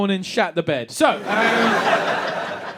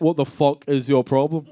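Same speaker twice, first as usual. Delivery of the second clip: put-on old Dundee man; 7 semitones lower than usual. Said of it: "some old bald guy from Dundee".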